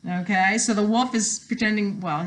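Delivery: ordinary delivery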